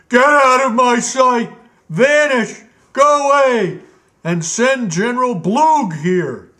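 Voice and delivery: high, loud raging orders; screaming royal fury; furious king shout. Acted. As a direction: drive him out of the room. King throws ruby, orders steward out.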